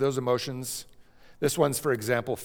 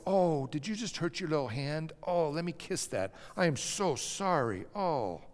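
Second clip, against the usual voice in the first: put-on soft, higher, tender comfort to a hurt baby; soothing mother; 4 semitones higher, 6 dB quieter than usual. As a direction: coo over the little hand like a gentle mother. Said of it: "look at the baby and go".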